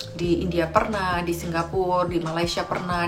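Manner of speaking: ordinary talk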